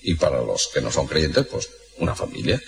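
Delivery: low, soft hesitant answer; uneasy Pope. Acted. The Pope answering the Turk, low, soft and reluctant. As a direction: answer reluctantly, deep and quiet.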